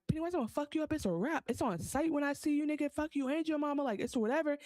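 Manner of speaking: retold kid's trash talk, high; tough kid threat. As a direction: puff up like a kid talking big. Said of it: "He's like".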